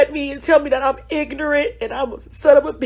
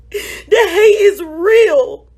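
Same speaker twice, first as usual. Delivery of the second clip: slow, tearful, voice breaking; crying; 4 semitones higher, 5 dB louder, much slower than usual. Real, not acted. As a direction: let the tears through each word.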